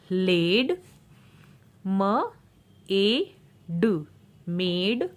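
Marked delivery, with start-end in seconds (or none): 1.9-4.1 म, ए, ड each said alone, pauses between; sounding out. Shown as a demonstration. Phonics teacher showing long A, sounding out म, ए, ड. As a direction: break it into म, long ए, ड. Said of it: "ए का long sound होगा".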